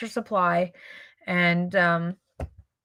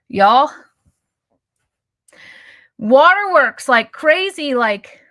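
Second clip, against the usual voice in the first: louder, choked-up emotional telling; real welling tears; 3 semitones higher, 8 dB louder, slower than usual. Real, not acted. Vlogger tearing up while telling her viewers, choked. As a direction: fight back tears and push on, louder. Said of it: "I'm gonna cry right now".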